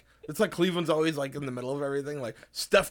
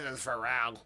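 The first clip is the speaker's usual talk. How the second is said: imitating [name]; 3 semitones lower, 4 dB quieter than usual.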